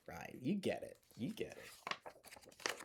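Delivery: slow soft whisper. Acted. Storyteller whispers to listening children. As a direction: breathe it slowly to the kids.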